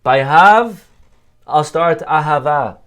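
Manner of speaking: slow, deliberate stress on Hav and Ahava; Hebrew wordplay. Shown as a demonstration. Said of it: "by giving you, I'll start loving you".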